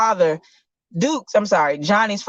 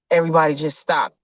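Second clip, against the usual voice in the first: ordinary talk, nothing marked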